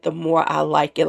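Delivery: usual speaking voice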